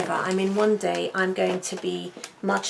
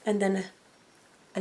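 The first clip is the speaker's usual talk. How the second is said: normal talking voice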